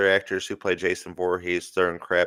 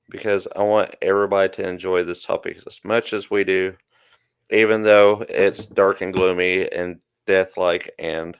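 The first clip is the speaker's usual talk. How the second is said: ordinary talk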